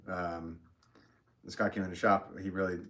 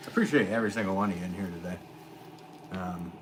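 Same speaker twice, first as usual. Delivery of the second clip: ordinary delivery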